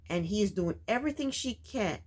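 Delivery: ordinary talk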